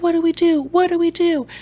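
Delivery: high, frantic repeated question; freaking out. Retold or imitated. Friends panicking in the car, high frantic plea. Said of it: "they're freaking out".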